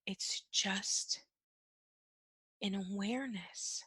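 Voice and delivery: very soft, slow, with a pause after "just"; hushed repetition for emphasis. Shown as a demonstration. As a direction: let it sink in, almost whispering "It's just... an awareness". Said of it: "I'm gonna say that again".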